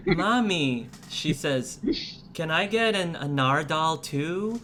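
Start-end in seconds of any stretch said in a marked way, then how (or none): 0.1-0.9 calling mommy. Acted. Girl calling her mother. 2.3-4.6 put-on higher, eager child voice asking; a kid begging for a toy; pleading little girl. Girl asking her mommy for a plush, in a higher eager child voice.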